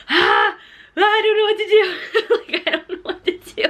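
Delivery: high-pitched wailing, breaking into laughter; mock panic. New mom reliving store meltdown, laughing mock panic. Acting out parents' panic, baby screaming.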